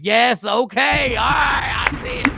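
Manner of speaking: slow, loud "yes, okay"; excited shout. Real, not acted. Host hyping a viewer's comment, loud shout. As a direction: holler in approval.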